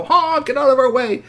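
high, loud honk and yell; shouted warning. Acted. Boys pedaling down the street at cars, yelling. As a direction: blare it like a horn.

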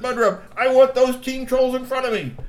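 high, loud command; barked order. Acted. Mister Glove commands soldiers, high and loud. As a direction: order them out.

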